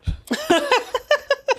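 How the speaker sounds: high laugh. Real, not acted. Laugh mid retold quarrel.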